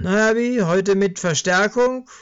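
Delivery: high, loud, hearty greeting; jovial stall owner. Acted. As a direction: greet a regular cheerfully. (herzlicher, hoher Gruß des Budenbesitzers)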